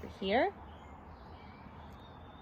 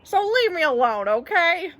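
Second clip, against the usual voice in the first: high, loud plea to be left be; exasperated; 7 semitones higher, 4 dB louder than usual. Acted. Bert wants Ernie off his back, raised and exasperated. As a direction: push it out in a raised, fed-up voice.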